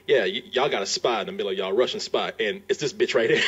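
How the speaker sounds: a hint of a blunt defector ratting her out; mock defector. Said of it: "he doesn't necessarily say it that way".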